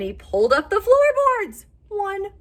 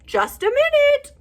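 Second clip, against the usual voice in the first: high, louder call to the door; 5 dB louder than usual. Acted. Owl calling to door.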